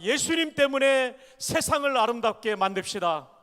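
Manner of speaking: loud, ringing line for the congregation to repeat; call for repetition. Shown as a demonstration. Preacher leading the congregation in a repeated line, proclaiming loudly. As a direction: proclaim it so the church can echo you. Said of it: "마지막으로 따라합니다".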